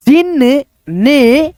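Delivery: जिन ने read very slowly, each sound drawn out; slow careful reading. Shown as a demonstration. Teacher reads slowly for children learning to read. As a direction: spell out जिन ने slowly for the class. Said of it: "जिन ऐसे".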